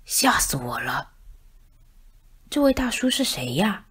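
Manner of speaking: a frightened little murmur; scared mumble. Acted. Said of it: "小声嘀咕开了". (三只小老鼠害怕地小声嘀咕)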